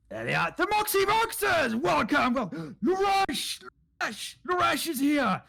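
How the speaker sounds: high shouted hobgoblin cheer; excited hobgoblin. Acted. Hobgoblins hail the returning party, excited shout. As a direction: shout it high, like a thrilled crowd of goblins.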